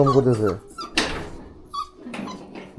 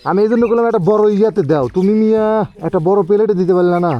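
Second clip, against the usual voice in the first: scolding; 6 semitones higher, 5 dB louder than usual. Real, not acted. Scolding for the small plate.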